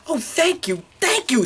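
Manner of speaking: high-pitched grateful gush; effusive relief. Acted. Principal relieved baby is quiet.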